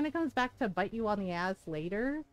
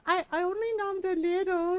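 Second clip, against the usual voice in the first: cutesy excuse; 8 semitones higher than usual. Retold or imitated. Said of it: "And I was just like".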